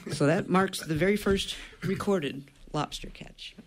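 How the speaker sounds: normal talking voice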